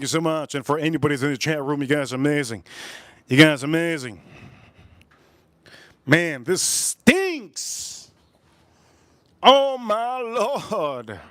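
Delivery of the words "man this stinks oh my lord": slow, drawn-out dismay, pitched higher; disgusted groan. Real, not acted. Recoiling from the fragrance's smell in slow, high dismay. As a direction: moan it like the smell hurts.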